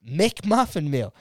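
slow, deliberate words, laughing at double; over-enunciate it slowly for him; slow spelled-out order. Retold or imitated. Repeating the order slowly to the drive-through worker. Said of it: "I'ma say it one more time".

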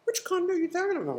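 high, laughing tease. Real, not acted. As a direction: tease her while laughing. Teasing about the condo.

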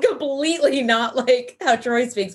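normal talking voice

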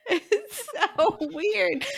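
slow, high, through laughter; giggling disbelief; laughing. Real, not acted. Still laughing.

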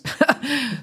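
short high-pitched chuckle; amused laugh. Real, not acted. Storyteller amused at calling his accent weird, short laugh.

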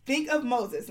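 ordinary talk, nothing marked

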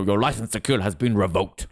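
lightly imitated line delivery, pitched low; a hint of a low-voiced film character; Gordon Lee Brown. Fan quoting a favourite Bond scene, lightly imitated delivery. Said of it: "it's the delivery that old Gordon Lee Brown says it".

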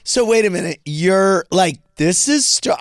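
theatrical disbelief. Retold or imitated. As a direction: ham up the disbelief at the counter. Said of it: "do the whole thing".

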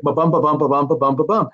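"Ba-bum" tapped out as da-DUM, weight on "bum"; iambic rhythm. Shown as a demonstration. Writer showing the interviewer his script's meter, rhythmic da-DUM beats. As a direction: beat out the iambic meter, "ba" light, "bum" strong. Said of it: "but it was iambic".

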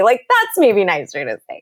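ordinary delivery

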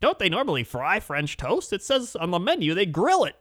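put-on questioning the menu like an anxious old woman; hammed-up fretting elderly wife; fussy old woman. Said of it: "she was like".

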